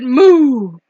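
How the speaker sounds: long drawn-out moo; cow moo. A narrator's reading. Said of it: "Cow said".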